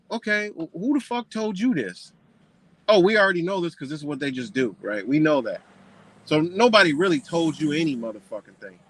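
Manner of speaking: ordinary talk, nothing marked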